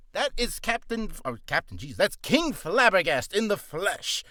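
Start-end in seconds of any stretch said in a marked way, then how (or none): none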